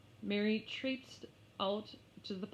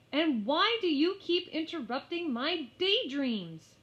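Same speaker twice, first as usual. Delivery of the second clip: complaining; 8 semitones higher, 7 dB louder than usual. Acted. Mary complaining to lamb.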